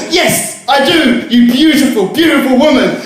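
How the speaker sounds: reply proudly, half laughing; smitten reader. Acted. Reader to the girls, hammed-up protest.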